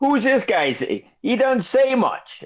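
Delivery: high, slow merchant voice; shopkeeper voice. Acted. Shopkeeper remarks on quiet guest.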